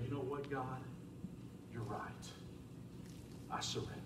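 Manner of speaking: whispered prayer of surrender; quiet surrender. Acted. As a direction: give up to God in a hush.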